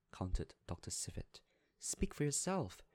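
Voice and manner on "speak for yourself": higher, sharp retort; a drunk guest's violent outcry, pitched high; cried violently. A narrator's reading. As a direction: snap back at the doctor with heat. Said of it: "cried Miss Baedeker violently".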